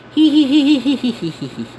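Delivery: high-pitched hee-hee cackle; high giggle. Acted. Thief gloating over treasure, high hee-hee giggle.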